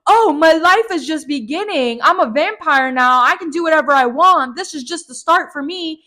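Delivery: high, bright mock glee; mock her sudden joy; gleeful Alice. Vlogger mocks Alice's new joy, high mock glee. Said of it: "it's like".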